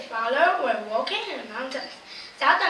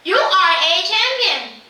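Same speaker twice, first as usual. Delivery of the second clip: high, loud shout of praise; the boy screams toward the mountain, high and loud; 4 semitones higher, 8 dB louder than usual. A narrator's reading. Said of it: "Again the man screams".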